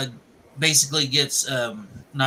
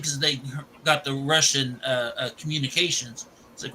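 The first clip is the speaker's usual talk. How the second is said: ordinary talk, nothing marked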